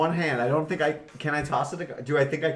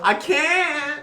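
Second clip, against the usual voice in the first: higher, louder character cry; dangling plea; 14 semitones higher, 6 dB louder than usual. Acted. Rogar calls from the rope, higher and louder. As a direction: call out stuck on the rope.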